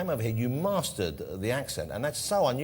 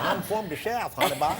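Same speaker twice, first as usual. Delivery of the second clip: put-on laughing Southern accent, pitched higher; mock Southern accent; 8 semitones higher, 3 dB louder than usual. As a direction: play a sweet Southern belle teasing a guest in a Southern accent. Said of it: "Now I know that you're not from anywhere".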